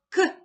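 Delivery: К snapped out high and loud; isolated sound. Shown as a demonstration. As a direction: sound out К sharply. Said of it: "Літак".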